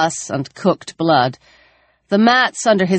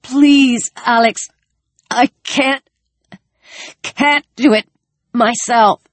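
high, breathless pleading; out of breath; 7 semitones higher than usual. Acted. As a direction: gasp out each broken phrase. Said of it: "his chest heaving".

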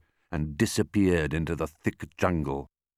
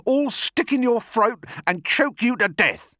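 very high monkey tirade; screech-like curse, pitched right up; outraged cry; 3 dB louder than usual. A narrator's reading. Mugglewump curses the crocodile, high frantic outrage. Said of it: "cried Mugglewump".

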